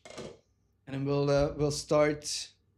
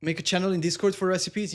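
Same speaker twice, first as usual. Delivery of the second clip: ordinary talk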